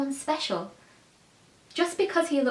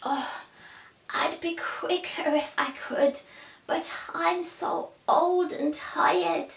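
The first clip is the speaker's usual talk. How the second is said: put-on frail old man, softer, slow on the last words; lightly voiced weary elder, dragging the words; tired old man; 6 semitones higher, 4 dB quieter than usual. A narrator's reading. Old man telling Beam he is too tired to move, soft and slow. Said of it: "The old man said".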